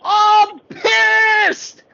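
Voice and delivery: high, loud mock outburst; hammed-up angry cry; imitating Liz fuming. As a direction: blurt it like she's fed up and done. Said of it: "and then Liz goes".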